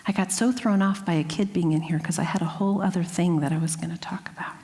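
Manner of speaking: real whispered aside to the room; hushed aside. Real, not acted. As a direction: drop to a whisper as a side remark. Aside about the kid in room.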